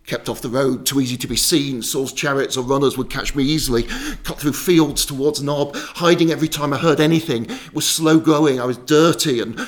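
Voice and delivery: loud, driven account of flight; urgent narration. A narrator's reading. Fleeing Saul's runners through fields, urgent narration. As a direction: push the story on loudly, urgently.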